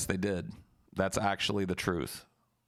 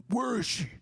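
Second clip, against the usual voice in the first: much higher squeaky demanding voice; mock angry friend; 14 semitones higher than usual. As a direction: burst in shrieking the question. Yoshi busting in.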